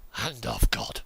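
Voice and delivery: mock reverent whisper. Crane drops container, mock awe.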